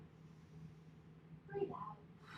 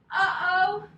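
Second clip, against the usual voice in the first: high, loud sudden alarm; 6 semitones higher, 11 dB louder than usual. Acted. Story teacher to kids, loud alarmed uh-oh. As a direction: gasp out an uh-oh at the cave.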